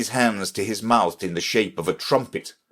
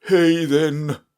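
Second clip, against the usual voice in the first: ordinary delivery, nothing marked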